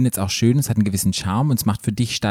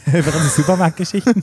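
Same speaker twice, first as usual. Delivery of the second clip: higher, laughing through the words; 6 semitones higher than usual. Real, not acted. Laughing to co-host about supermarket stories. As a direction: chuckle at the silly topic.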